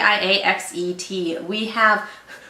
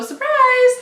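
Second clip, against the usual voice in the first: excited; 6 dB louder than usual. Real, not acted. Announcing a surprise.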